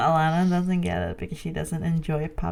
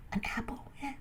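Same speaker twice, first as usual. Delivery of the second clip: whisper; 4 semitones higher, 14 dB quieter, much faster than usual. Retold or imitated. Satan tempting in whisper.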